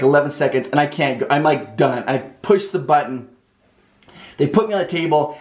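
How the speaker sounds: ordinary delivery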